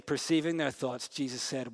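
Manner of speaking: usual speaking voice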